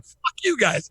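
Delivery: defiant brush-off. Retold or imitated. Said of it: "it's just like".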